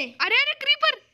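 very high panicky yelp; startled alarm. Real, not acted. Player suddenly sees a creeper, startled alarm. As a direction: cry out a sudden warning.

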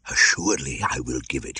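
gleeful whispered snake; evil delight. Acted. The cobra promising the treasure, whispered and gleeful. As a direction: hiss it with wicked glee. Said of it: "fairly shook with evil delight".

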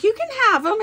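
high loud offer; high excited retold offer. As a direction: toss out the offer brightly. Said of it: "I was like".

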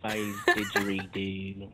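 low, soft, slow Australian mimicry; mock Australian accent. Friend mimics the accent attempt, low slow Australian. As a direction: echo it slowly in mock Aussie, dropping low.

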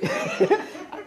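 short airy giggle at herself; breathy laugh. Real, not acted. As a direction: laugh it off, embarrassed. Laughs recalling teenage looks.

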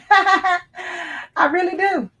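high laughing, slowly drawn out. Real, not acted. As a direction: laugh it out, delighted. Laughing at the wine sign.

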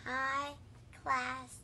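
halting, low reading, one word at a time. Real, not acted. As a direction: read slowly, pausing after each word. Child reads message word by word.

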